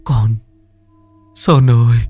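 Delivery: a father choking up at his son's voice; trembling father. Acted. Father hears his dead son's voice, trembling and tearful. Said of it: "Ông run run".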